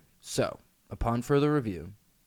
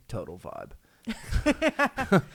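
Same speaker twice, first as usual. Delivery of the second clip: laughing; 9 semitones higher, much slower than usual. Real, not acted. Laughing mid-sentence.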